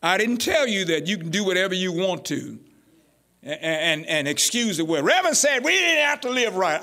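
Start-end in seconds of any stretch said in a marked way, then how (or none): 5.0-6.8 higher-pitched mimicry of congregants; mock misquoting. Preacher imitating members twisting his words, mock excuse. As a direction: lightly parody their excuse.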